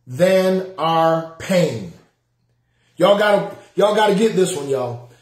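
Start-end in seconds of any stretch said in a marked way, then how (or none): 0.0-1.9 very slow, each word laid down; slow it right down so they can type it; slow emphasis. Shown as a demonstration. Preacher dictating the principle to the live chat, very slowly. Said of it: "Somebody type this in for me".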